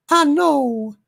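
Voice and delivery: high-pitched Southern belle lilt; hammed-up falsetto belle. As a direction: play a gracious Southern lady, very high. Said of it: "Southern Belle Doomcock says".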